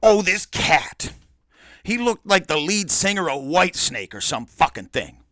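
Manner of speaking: ordinary delivery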